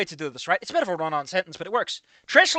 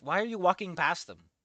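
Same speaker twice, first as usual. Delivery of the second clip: ordinary talk, nothing marked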